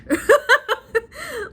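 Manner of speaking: loud, high burst of laughter. Real, not acted. Vlogger laughing at how harsh Joan is, high laugh.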